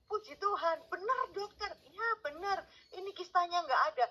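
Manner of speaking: high, jubilant cry of thanks; joyful retold exclamation, pitched high; overjoyed praise. Her joy at the cyst gone.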